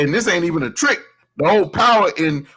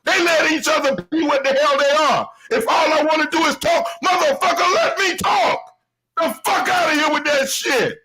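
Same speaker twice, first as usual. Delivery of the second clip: raised, higher ranting voice; heated rant; 8 semitones higher than usual. Real, not acted. Ranting at YouTube critics, voice raised high. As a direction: rant at the critics, pitched way up and pushing.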